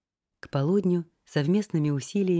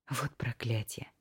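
hushed, slow muttered curse; whispered curse; 4 semitones lower, 8 dB quieter, slower than usual. A narrator's reading. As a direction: breathe it out under your breath.

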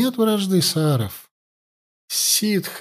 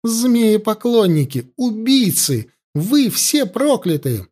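higher, slow, accusing cry; shouting curse; 8 semitones higher, slower than usual. A narrator's reading. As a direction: curse them to their faces. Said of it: "закричала Юна".

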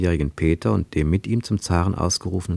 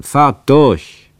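loud, higher, irritated bark; annoyed shout; 6 semitones higher, 10 dB louder than usual. Retold or imitated. Narrator voicing the annoyed policeman waving them through, loud shout. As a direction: snap the order out like a fed-up policeman. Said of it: "gab verärgert ein Zeichen".

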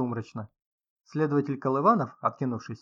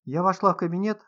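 higher-pitched nervous stammer; stammering babble; 5 semitones higher, 4 dB louder than usual. A narrator's reading. Writer explains finding the body, stammering nervously. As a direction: falter on the first word, then blurt it. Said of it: "заикаясь, пролепетала Прасковья Фёдоровна".

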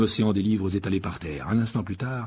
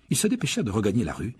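ordinary delivery

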